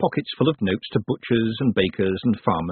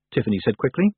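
ordinary delivery

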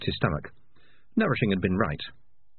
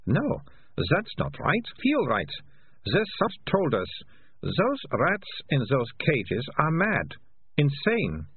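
higher, deliberate character voice; lightly voiced girl reasoning aloud; 6 semitones higher, slower than usual. A narrator's reading. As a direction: work it out step by step, pitched up.

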